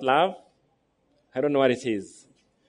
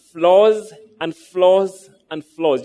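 drawn-out repetition; 2 semitones higher, 5 dB louder, much slower than usual. Real, not acted. Preacher stretches repeated words.

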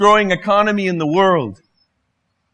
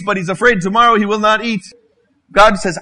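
usual speaking voice

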